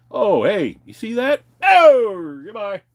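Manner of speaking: high and loud mock cheer; fake-friendly setup. Pretending to show his friend the view before dumping him, high and loud. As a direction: bait him with a sightseeing tone. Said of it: "Take you out over to fucking Red Rocks".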